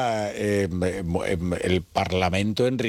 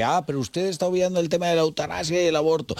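higher-pitched mock objection; voice of the right; 8 semitones higher than usual. Right-wing side answering the left, lightly mimicked objection. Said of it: "una parte de la derecha dice".

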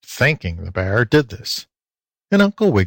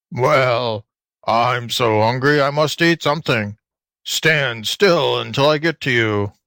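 lightly voiced hungry alligator with a mild growl; a hint of a hungry gator's growl; 3 semitones higher than usual. A narrator's reading. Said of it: "The gator growled".

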